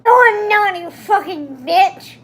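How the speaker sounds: hammed-up angry shout. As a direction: spit it back furiously. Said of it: "he shouted angrily".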